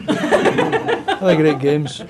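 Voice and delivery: slow, laughing, reluctant Have a great games; grudging, dragged out. Retold or imitated. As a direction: stretch it out as if it costs him. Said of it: "holding on to that bit of dignity".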